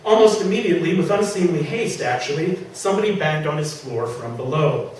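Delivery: plain reading voice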